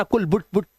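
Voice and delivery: कुल, बुट cut short on the u; कुल, बुट as quick short-u syllables; wrong short vowels. Shown as a demonstration. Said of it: "ये मत पढ़ना".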